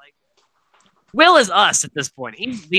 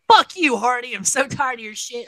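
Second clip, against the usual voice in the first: mock angry yell at Hardy, pitched a bit higher; a retold blow-up, not a full scream; retold angry outburst; 5 semitones higher than usual. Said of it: "Kent blew the fuck up".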